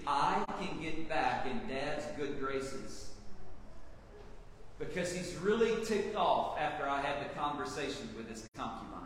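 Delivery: Reuben's scheming thoughts. Acted. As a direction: muse quietly to yourself.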